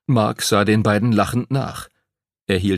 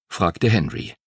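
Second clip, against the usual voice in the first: plain reading voice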